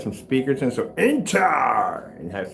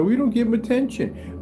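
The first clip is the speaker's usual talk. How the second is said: imitating whiny cat; 11 semitones higher than usual. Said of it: "He'll sit there and scream".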